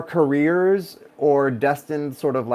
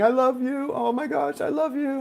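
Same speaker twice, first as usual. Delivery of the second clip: sister saying I love you; 12 semitones higher than usual. Retold or imitated. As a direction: gush it like a missed sister. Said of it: "she kept saying".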